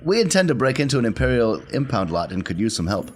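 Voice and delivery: normal talking voice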